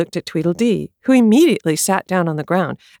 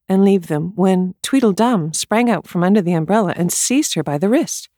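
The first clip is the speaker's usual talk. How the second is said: plain reading voice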